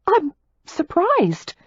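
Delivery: high, slow startled reply; drawn-out astonished squeak; surprised. A narrator's reading. Daisy voiced.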